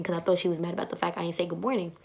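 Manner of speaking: ordinary talk, nothing marked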